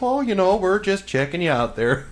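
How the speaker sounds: high, loud mock Canadian accent; hammed-up friendly Canadian checking in; playful Canadian lizard neighbour. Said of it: "It's very Canadian of them".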